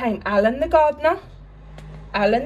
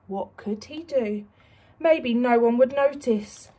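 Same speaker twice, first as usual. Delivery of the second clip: plain reading voice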